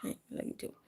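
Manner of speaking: hushed fond words to a pet; whispered love. Real, not acted. Owner whispers affection to the cat climbing on her.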